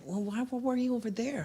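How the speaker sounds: a hint of a puzzled mother; mother's question. Mother questions little girl.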